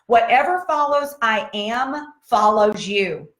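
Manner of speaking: slow, weighty delivery of the saying; slow quoted maxim. Shown as a demonstration. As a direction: lay out each word like a motto. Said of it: "who taught years ago".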